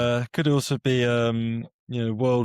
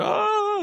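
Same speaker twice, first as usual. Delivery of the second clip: freaked-out cry. Acted. Acting out the killer freaking out, high mock scream. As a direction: squeal out a panicked mock yell. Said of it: "And they freaked out".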